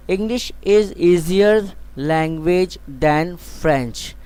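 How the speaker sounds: very slow, clear classroom reading; slow example sentence. Shown as a demonstration. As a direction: read it slowly so students can write. Teacher reads example sentence.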